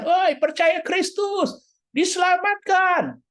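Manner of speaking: high, slow, drawn-out street call; mock street preacher. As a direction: lampoon a street preacher calling out to passers-by. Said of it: "nggak usah teriak di jalan-jalan". (mengejek pengkhotbah jalanan yang berteriak)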